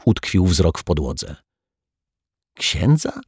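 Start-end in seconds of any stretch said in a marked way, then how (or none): 2.6-3.2 high, questioning echo; wondering repetition. A narrator's reading. Detective puzzling over the altar boy's request, wondering. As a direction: echo it back with pitch raised, pondering. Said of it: "powtórzył z zastanowieniem detektyw".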